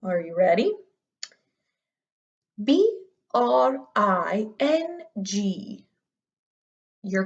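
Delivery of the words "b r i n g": slow, very proper royal spelling; king or queen voice. Shown as a demonstration. Teacher demos a regal voice for third graders. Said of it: "a king or queen voice, so very proper".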